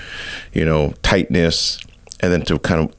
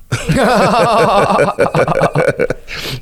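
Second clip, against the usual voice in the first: loud high burst of laughter; rueful laugh about yoga for life; real laugh; 4 dB louder than usual. Real, not acted. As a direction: laugh out at the joke. Laughing at own joke.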